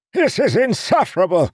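put-on very high, loud old man; outraged. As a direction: sputter with fury. Seer furious.

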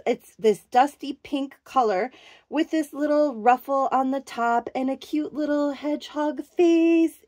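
normal talking voice

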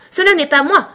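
high, loud, hasty protest; lively defensive retort; quick denial. A narrator's reading. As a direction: deny it at once, pitch jumping up. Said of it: "répliqua vivement le lori".

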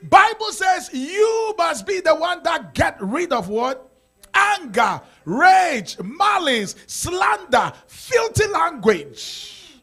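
loud, high preaching, the list of sins shouted; full-force preaching. Acted. Pastor shouting the list of sins to the congregation, each word hurled. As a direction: shout each sin out from the pulpit.

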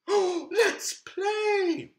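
very high, slow and thrilled; a monster bursting with joy; delighted. Acted. Delighted monster after a gasp.

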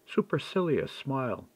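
usual speaking voice

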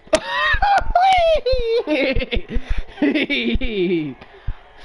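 high shouting burst of laughter; roaring laugh at the screen; loud laugh. Real, not acted. Big laugh at the game.

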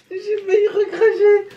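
high laughing while gagging. Real, not acted. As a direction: laugh through near spitting. Laughing after nearly spitting out.

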